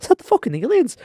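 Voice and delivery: high-pitched startled question; high, comic disbelief; mock alarmed. Acting out startled reaction to aliens.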